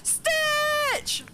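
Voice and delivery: high-pitched gushing cry; fangirl squeal. Real, not acted. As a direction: call out the name as fangirlishly as possible. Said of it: "as fangirlish as possible".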